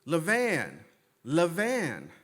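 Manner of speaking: high, loud calling out, falling at the end; mock scream. Writer hailing the wrong man from behind, mock scream. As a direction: imitate someone yelling a name to get attention. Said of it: "I hear someone screaming".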